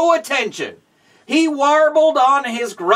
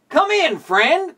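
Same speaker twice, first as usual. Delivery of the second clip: friendly call; 8 semitones higher than usual. Acted. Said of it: "called one of the bears".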